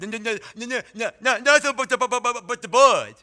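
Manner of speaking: put-on excited childish stutter. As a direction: stammer out each word. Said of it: "he ran up and stuttered".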